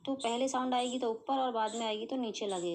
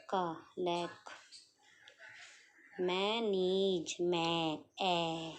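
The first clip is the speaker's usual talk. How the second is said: लैक and मैं stretched very slowly; teacher sounding out manage; 3 semitones lower, much slower than usual. Shown as a demonstration. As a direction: draw out every sound. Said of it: "first place की आ रही".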